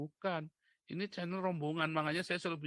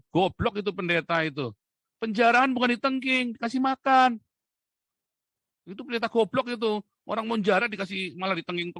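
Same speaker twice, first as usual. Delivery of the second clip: loud, raised-voice scolding; angry rant; 6 semitones higher, 11 dB louder than usual. Real, not acted. Preacher denouncing another pastor over looting, angry shout. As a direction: tear into the foolish teaching, shouting. Rants at a pastor's foolish teaching.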